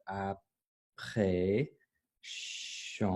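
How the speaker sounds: slow, hushed, stretched syllables; sounding out. Real, not acted. Teacher checking the word for a hyphen, softly sounding it out. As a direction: sound it out softly to yourself, slowly. I just wanna make sure.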